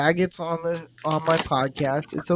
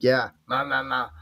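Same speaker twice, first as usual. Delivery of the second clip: dismissive nah nah; 4 dB louder than usual. Retold or imitated. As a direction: brush it off with a lazy nah. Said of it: "You nod your head like".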